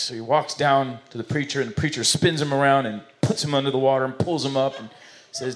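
normal talking voice

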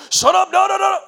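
very high, loud "Shut up, no"; mock shouted hush. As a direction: shout him down, hammed up. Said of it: "they're like".

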